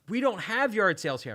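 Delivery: high, loud mock protest; imitated objector. UK listeners correcting the host, high mock protest. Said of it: "they're like".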